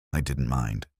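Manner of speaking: plain reading voice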